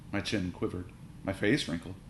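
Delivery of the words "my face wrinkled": usual speaking voice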